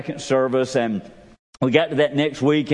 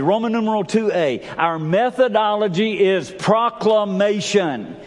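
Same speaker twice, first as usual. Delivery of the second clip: high-pitched, emphatic sermon; full-force preaching; 7 semitones higher than usual. Acted. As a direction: build to high, ringing calls and drive each point home.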